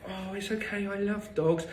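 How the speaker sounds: put-on high, soft gushing baby voice; baby talk. Mocking a stranger cooing at a nervous dog, high soft baby talk. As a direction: coo at the dog like it's a baby. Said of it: "Don't start baby talking the dog".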